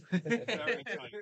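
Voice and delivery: "Very tight" stretched slowly through a laugh; laughing slow answer. Real, not acted. Answers a friend's teasing question slowly while laughing. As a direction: drag it out while cracking up.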